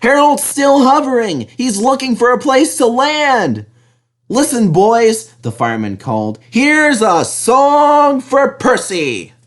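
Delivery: put-on rally the boys; cheerful fireman. A narrator's reading. Fireman calls the crew to sing for Percy.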